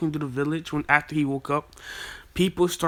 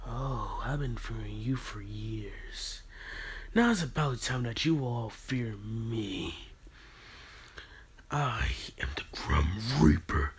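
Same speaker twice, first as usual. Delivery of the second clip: low, much softer whispered threat; sinister whisper; 4 semitones lower, 5 dB quieter than usual. Acted. Naruto declaring himself the Grim Reaper in a low whisper. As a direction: whisper it like death itself.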